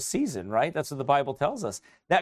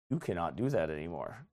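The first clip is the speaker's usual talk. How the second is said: voice of God; 9 semitones lower, 9 dB quieter than usual. Acted. God's voice quoted to believer.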